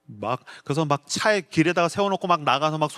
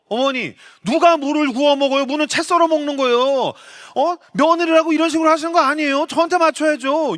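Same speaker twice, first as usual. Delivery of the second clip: higher, louder indignant protest; indignant daughter-in-law; 10 semitones higher, 5 dB louder than usual. Retold or imitated. Daughter-in-law talking back to her mother-in-law, indignant and loud.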